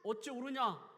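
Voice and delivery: loud, high voicing of God rebuking; God's voice. God rebuking Jonah, loud and high. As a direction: call out 요나야 like a scolding father. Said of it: "하나님 말씀하시잖아요".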